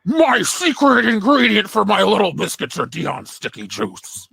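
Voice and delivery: high talking horse, dropping off at the end; put-on horse character voice; Nixon-like horse voice. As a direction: ham it up as a horse doing Richard Nixon. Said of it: "Should I do it in the voice?".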